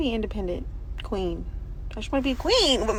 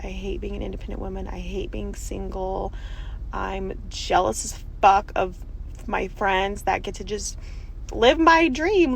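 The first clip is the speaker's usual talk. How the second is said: ordinary talk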